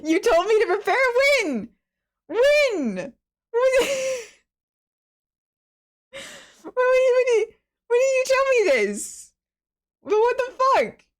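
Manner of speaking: very high, half-laughing outrage; panicked, squeaky indignation; incredulous protest. Real, not acted. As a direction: squeal it in real disbelief, breaking into laughter. Protesting unannounced accent, laughing.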